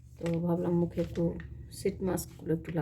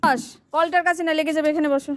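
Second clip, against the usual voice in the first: loud, high warning call; 9 semitones higher, 10 dB louder than usual. Real, not acted. Mother warning her daughter away from the tap, loud and high.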